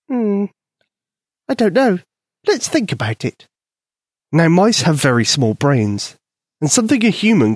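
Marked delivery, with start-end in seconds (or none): 0.1-3.5 high, tiny mouse voice; squeaky mouse voice. A narrator's reading. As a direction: voice a small eager mouse. Mouse thinking aloud.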